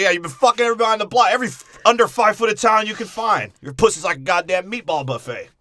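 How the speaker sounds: fast, loud, high ranting; mock rant. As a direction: rattle off a hammed-up rant. Mock rant voiced in riff.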